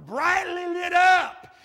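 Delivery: brightly lit up stretched long and shouted high; loud drawn-out call. Retold or imitated. Old man to bartender, shouting brightly lit up slowly. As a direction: belt out brightly lit up slowly, like a proud churchgoer.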